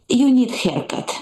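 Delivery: put-on slow, blunt Eastern European accent; mother's critical Eastern European accent. Mother criticizing daughter's hair, Eastern European accent. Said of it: "That's the accent".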